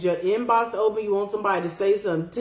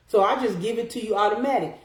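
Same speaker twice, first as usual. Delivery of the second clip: ordinary talk, nothing marked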